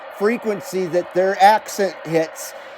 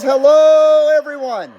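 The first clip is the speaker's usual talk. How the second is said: cheery hello; 7 semitones higher, 6 dB louder than usual. Retold or imitated. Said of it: "Ron says".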